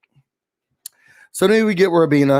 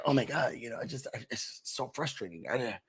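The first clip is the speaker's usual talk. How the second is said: much softer, mumbled whine; quietly imitating a lost guy; mopey mock houseguest; 3 semitones lower, 15 dB quieter than usual. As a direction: mumble it like a confused bro.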